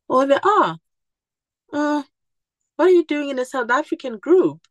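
surprised. Retold or imitated. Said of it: "And I was like".

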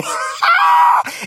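very high shrieking outburst. Real, not acted. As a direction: let out a piercing laugh-shriek. Breaks up after a difficult line.

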